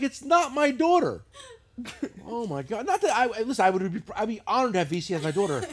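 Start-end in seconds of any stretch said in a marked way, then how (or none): 0.0-1.2 high, drawn-out protest; exasperated denial. Retold or imitated. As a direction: protest slowly, near laughing. Hundredth denial, retold.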